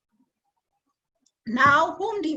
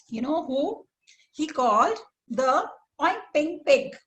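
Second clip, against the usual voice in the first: usual speaking voice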